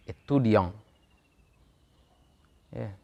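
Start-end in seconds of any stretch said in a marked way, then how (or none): 0.1-0.7 Etudiant said the French way, final t silent, nasal ending; French pronunciation. Shown as a demonstration. Teacher shows students French spelling vs sound, French Etudiant. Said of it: "Bahasa Prancis".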